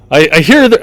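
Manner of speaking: put-on high, loud, faltering Scottish accent; attempted Scottish accent. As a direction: stumble through a put-on Scottish brogue. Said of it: "talks in a Scottish accent".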